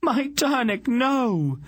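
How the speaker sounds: very high grief-stricken wail; anguished cry. A narrator's reading. Aunt rushes to the fallen man, wailing. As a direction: wail it out far above normal pitch. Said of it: "she cried out".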